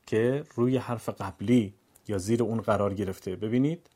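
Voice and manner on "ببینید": ordinary talk, nothing marked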